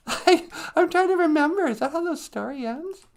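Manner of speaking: very high-pitched character voice; squeaky sidekick. Pretend. Sidekick voice answers the storyteller.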